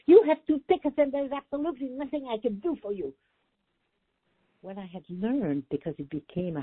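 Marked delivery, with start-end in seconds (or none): 0.1-3.1 quoting an exhausted clerk's snapped reply, pitched up; a mild mock shout at the counter; retold shout. Clerk shouting at the traveler at the ticket counter, mock shout. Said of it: "she shouted at me".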